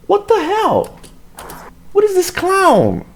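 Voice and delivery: very high-pitched, incredulous cry; exasperated outburst. Real, not acted. Commentator reacting to the officer's excuse, incredulous outburst. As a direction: squeal in outraged disbelief.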